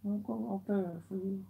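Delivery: quiet, hushed comfort; soft consoling. Real, not acted. Comforting his crying girlfriend, hushed and gentle. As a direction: speak softly close to her.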